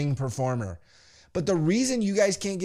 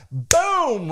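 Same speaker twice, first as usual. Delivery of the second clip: high, punchy boom; exclaimed impact; 7 semitones higher, 4 dB louder than usual. Retold or imitated. Telling how Roman lands a punch, exclaimed boom. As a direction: punch the word out as the hit lands.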